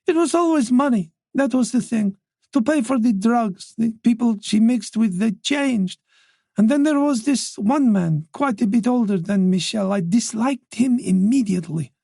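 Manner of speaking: put-on an elderly mother, voice lifted by worry; anxious old mother voice. Wife on daughter's friends.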